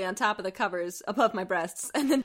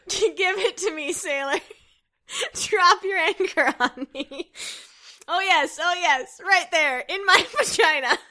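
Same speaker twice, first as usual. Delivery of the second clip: slow, louder, over-the-top seductive moaning; hammed-up dirty talk; 6 semitones higher, 7 dB louder, slower than usual. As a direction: ham up bad porn lines while half laughing. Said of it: "I tried to moan seductively".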